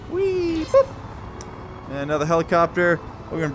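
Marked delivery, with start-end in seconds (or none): 0.1-0.9 high, drawn-out squeal; playful whee. Pretend. Player flying a plane around, high playful whee. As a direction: sing out a light, high whee.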